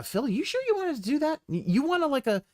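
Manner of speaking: high, tentative questioning; mock-delicate prompting. Offering Phil a redo on that, mock-delicately.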